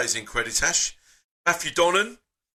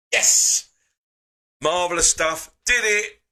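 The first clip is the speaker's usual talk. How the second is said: triumphant burst, loud and breathy; relieved little cheer; excited yes; 8 semitones higher, 4 dB louder than usual. Real, not acted. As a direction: celebrate the fix out loud. Screen capture finally works again.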